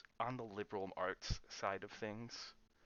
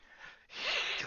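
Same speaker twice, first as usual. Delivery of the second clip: dramatic gasp; 4 dB louder than usual. Retold or imitated. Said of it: "communities where it's like".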